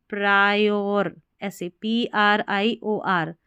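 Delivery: Priorities sounded out very slowly; priorities stretched, प्रायो first; slow model reading. Shown as a demonstration. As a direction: break priorities into pieces slowly. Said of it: "ऐसे साथ ही में पढ़ देना".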